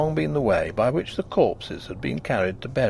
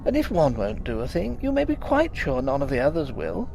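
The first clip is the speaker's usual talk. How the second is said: higher, fretful wife's voice; upset young wife; 9 semitones higher than usual. A narrator's reading. Wife telling her husband the maid is leaving, upset. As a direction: voice her tearful complaint.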